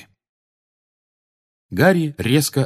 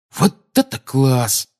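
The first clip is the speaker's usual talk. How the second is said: slow, higher-pitched admiration; approving; 4 semitones higher, slower than usual. A narrator's reading. Tonks admires Harry's new camouflage, approving. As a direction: draw it out, impressed and approving. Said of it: "одобрительно сказала Тонкс".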